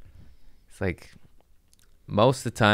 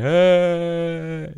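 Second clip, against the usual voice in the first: mock wail; 10 semitones higher, 4 dB louder than usual. Imitating daughter crying.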